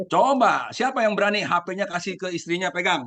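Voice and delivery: laughing challenge. Real, not acted. Speaker daring husbands to hand phones to wives, playful challenge.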